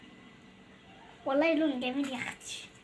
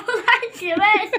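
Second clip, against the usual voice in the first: laughing; 4 semitones higher, 10 dB louder than usual. Real, not acted. Laughs at her own face.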